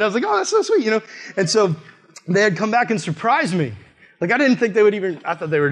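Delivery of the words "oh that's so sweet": very high, gushing retold coo; tearfully touched. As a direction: melt with mock-tearful tenderness, high voice. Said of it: "cried a little bit".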